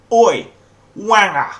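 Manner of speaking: loud, high Cockney yell with hard emphasis; harsh Mockney shout. Shown as a demonstration. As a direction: bellow it like a Cockney, K dropped. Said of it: "say it harshly and with emphasis".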